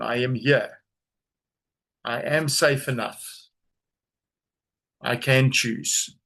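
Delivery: slow, deliberate affirmation; said slowly. Shown as a demonstration. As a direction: let each word land slowly. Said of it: "say this slowly".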